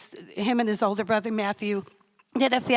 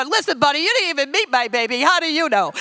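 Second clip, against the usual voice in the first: louder, combative comic scolding; South Side attitude; 3 semitones higher, 4 dB louder, faster than usual. Retold or imitated. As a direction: square up to him as a tough local. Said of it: "I turn in the South Side".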